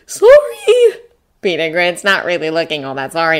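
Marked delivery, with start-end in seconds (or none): 0.0-1.0 put-on high-pitched excited kid; loud, squeaky little apology. A narrator's reading. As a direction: pipe up high like a jittery boy. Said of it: "enthusiastic jittering".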